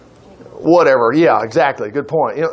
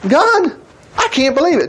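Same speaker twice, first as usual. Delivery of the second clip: mock dismay; 9 semitones higher than usual. Acting out David's cry to God.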